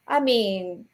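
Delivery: ordinary talk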